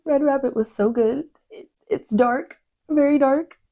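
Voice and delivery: swooning book fan, pitch way up; gushing. Real, not acted. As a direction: rave about it, voice soaring. Gushing about a favourite book.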